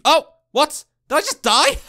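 high, loud startled disbelief; high loud shocked question. Real, not acted. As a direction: gasp it out in shock. Sudden death in game.

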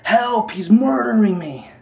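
half-voiced imitation of someone yelling for help; imitated cry for help. Recounting the yelling heard while outside talking to Chase, mild imitated yell. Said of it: "I heard someone yelling".

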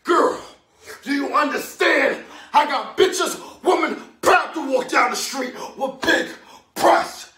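high, very loud tirade; raging father. Acted. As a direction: bellow at her in bursts, full force. Father raging at beaten mother.

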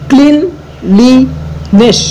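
Cleanliness very slowly, in separate syllables; split in three. Shown as a demonstration. Teacher showing three syllables, cleanliness slowly broken apart. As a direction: pause between the parts of cleanliness: clean, লি, নেস. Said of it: "এরকম তিনটে ভাগে ভাগ করা যেতে পারে".

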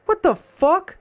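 high disbelieving yelp; shocked. Real, not acted. Player reacting to a weird boss, high stunned yelp. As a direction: jump way up in pitch, stunned. Shocked by strange game event.

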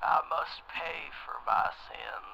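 slow hushed whisper; eerie whisper. Acted. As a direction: breathe it out like a spell. Entranced caller whispers confession.

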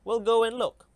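decide it brightly, ready to go; eager call. Acted.

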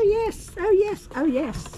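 ordinary talk, nothing marked

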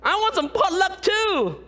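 ordinary talk

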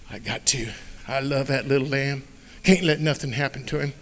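low and soft, loving; shepherd firmly telling wife he must go; tender shepherd. Acted. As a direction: quietly insist out of love.

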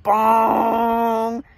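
held, resonant "bong"; cartoon impact drawn out on one note; imitated bonk. It hit him in the head again, imitated bonk.

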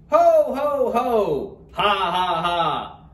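hearty ho-ho-ho, starting high and loud; belly-laugh in words, easing down at the end; Santa's laugh. A narrator's reading. Santa surprised by Turkey, hearty storybook laugh. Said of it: "laughed Santa".

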